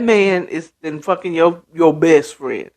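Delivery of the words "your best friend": ordinary talk, nothing marked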